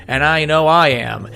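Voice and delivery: ordinary talk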